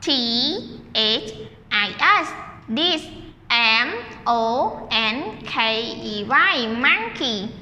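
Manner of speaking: slow letter-by-letter spelling; slow classroom spelling; spelling aloud slowly. Shown as a demonstration. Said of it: "Spell together".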